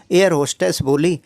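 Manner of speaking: usual speaking voice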